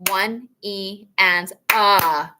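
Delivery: slow, spelled-out count one E and ah; teacher spelling out one E and ah; slow counting demo. Shown as a demonstration. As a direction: count it slowly, each syllable clear. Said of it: "So we say".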